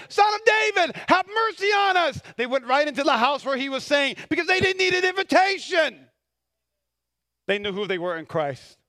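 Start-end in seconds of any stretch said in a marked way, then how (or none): 0.1-2.2 high, loud dramatized cry; shouting. Retold or imitated. Blind men following behind Jesus, acted shouting. 4.3-6.1 high, loud emphatic burst; preaching shout. Real, not acted. Preacher on blind men entering uninvited, emphatic shout.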